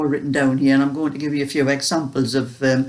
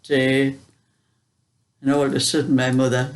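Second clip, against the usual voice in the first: ordinary talk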